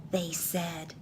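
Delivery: ordinary delivery, nothing marked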